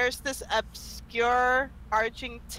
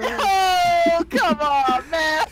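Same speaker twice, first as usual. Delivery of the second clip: laughing; 7 semitones higher, 6 dB louder, much slower than usual. Real, not acted. Joke reaction.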